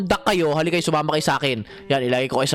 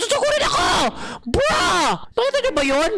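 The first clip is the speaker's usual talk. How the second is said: high startled yelp; high frantic alarm; panicked shout. Real, not acted. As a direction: shriek as the ducks charge. Ducks charging at him.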